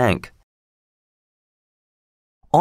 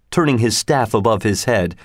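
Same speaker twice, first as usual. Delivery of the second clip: usual speaking voice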